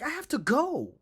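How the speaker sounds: high, loud send-up of bad acting; mock angry whine. Streamer imitating the actor leaving in anger, mocking. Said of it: "his eyes were like".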